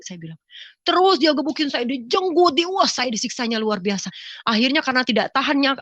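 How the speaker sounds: ordinary talk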